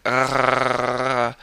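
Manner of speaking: a short trilled "Rrrr" on the tongue tip; rolled R. Shown as a demonstration. Teacher demonstrating a trilled R to German learners. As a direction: trill the R briefly. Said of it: "you don't have to have a long roll".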